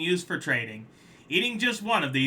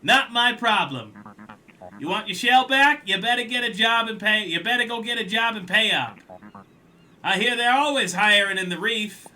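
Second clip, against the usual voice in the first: louder, brusque and dismissive; dismissive collector; 8 semitones higher, 7 dB louder than usual. Acted. Collector telling the crab to get a job, louder brusque. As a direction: shrug him off with a sneer.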